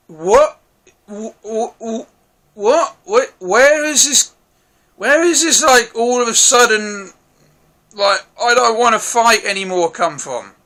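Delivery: acting out total bewilderment at the sudden change; baffled disbelief. Reviewer reenacting confusion at a plot twist, baffled and stammering. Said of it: "And I'm just like".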